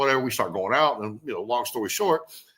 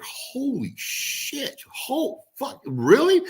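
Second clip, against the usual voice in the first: amazed; 12 semitones higher, much slower than usual. Retold or imitated. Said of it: "He's like".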